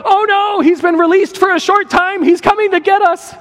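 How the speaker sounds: high, panicked squeal; hammed-up terror of a chasing army; freaking out. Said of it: "they freak out".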